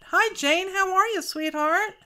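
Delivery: high, bright hello to a friend; cheery greeting. Real, not acted. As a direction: greet her sweetly and high. Greets viewer in live chat.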